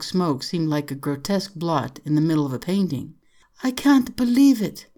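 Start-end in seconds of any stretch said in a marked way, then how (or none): 3.6-4.9 high-voiced girl in disbelief; disbelieving. A narrator's reading. Young girl peering down at the flames, disbelieving. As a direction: say it in stunned, high-pitched dismay. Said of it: "she said over and over".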